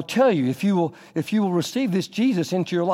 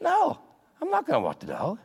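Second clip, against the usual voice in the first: pitched up high on no, then a petulant mutter; selfish refusal; 11 semitones higher, 3 dB quieter than usual. Pretend. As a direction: snap back no, then sulk through the rest. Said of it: "your first thought is".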